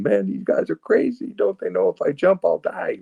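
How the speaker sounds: high-pitched, incredulous inner voice; incredulous thought. Retold or imitated. Man on the bridge thinking about the campers, incredulous. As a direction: marvel at how crazy they are. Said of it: "I'm looking down at these guys going".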